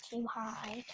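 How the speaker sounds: ordinary delivery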